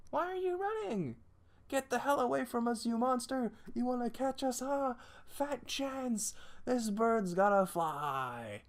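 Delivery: high, loud voice crowing at the chaser; squeaky fleeing character. Acted.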